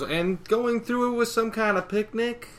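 high, climbing mock question; incredulous retort. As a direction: throw it back in rising disbelief. Applejack's sarcastic retort.

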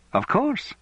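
high, surprised Welsh reply. Acted. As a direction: pitch it up in surprise. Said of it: "said the surprised voice".

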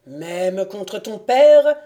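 loud, slow, drawn-out question; menacing challenge. A narrator's reading. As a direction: stretch it out loudly as a threat. Count advances threatening his son.

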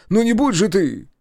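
very high, loud panic; frantic, climbing cries; howling plea. A narrator's reading. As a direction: wail desperately as danger approaches. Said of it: "взвыл тот".

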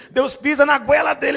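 dramatic build. Acted. Dramatic narration, much louder.

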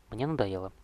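plain reading voice

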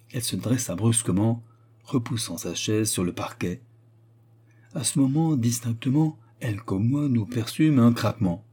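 plain reading voice

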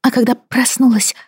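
high, timid, fading to whisper; frightened wife, barely speaking; timid young woman voice. Acted. Timid wife answers Brody.

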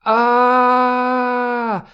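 long high wail of terror; drawn-out high scream; wild scream. A narrator's reading. Man screaming from the tunnel in terror, long high wail. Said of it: "вновь донеслось из тоннеля".

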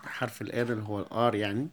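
ordinary talk

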